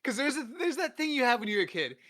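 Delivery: high, loud words through laughter; real laughing delivery. Real, not acted. Cracking up at co-host's joke.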